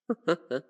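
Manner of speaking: short, knowing chuckle; brief, self-satisfied villain laugh; villainous chuckle. Acted. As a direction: chuckle like a vampire toying with prey. Villain amused by captive guest.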